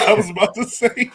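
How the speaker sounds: laughing through the words. Real, not acted. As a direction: repeat the tease, laughing. Repeats tease while laughing.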